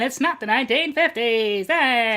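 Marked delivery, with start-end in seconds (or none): none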